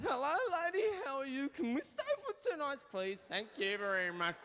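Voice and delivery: very high, goofy tourist; squeaky mock politeness of a clueless foreigner; silly foreigner's voice. Guest checking in as French hotel staff hear him, silly high voice. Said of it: "All they hear is".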